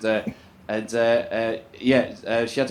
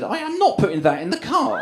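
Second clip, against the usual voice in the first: indignant refusal; 7 semitones higher than usual. Retold or imitated.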